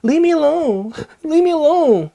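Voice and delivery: high, giggly, timid leave me alone; mock a kid who can't stand up for himself; weak laughing plea. Shown as a demonstration. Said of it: "Don't be laughing like".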